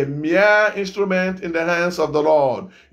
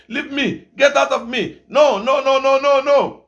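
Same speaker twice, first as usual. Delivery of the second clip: retold child shouting; 7 semitones higher than usual. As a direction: cry out like a terrified child pushing a demon away. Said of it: "One child started shouting".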